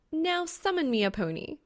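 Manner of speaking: lightly voiced playful demand; young woman's voice. A narrator's reading. Female character voiced by narrator.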